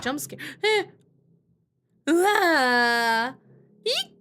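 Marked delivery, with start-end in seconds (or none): 2.1-3.3 startled scream. Real, not acted. Startled by jump scare. 3.8-4.1 startled shriek. Real, not acted. Startled by jump scare.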